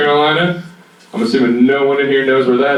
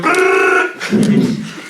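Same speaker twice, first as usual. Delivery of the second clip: high unvoiced pecking noise; imitated woodpecker rattle. Woodpecker drilling above the burrow, high pecking sound.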